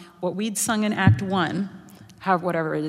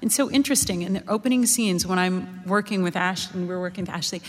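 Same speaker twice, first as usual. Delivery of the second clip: ordinary talk, nothing marked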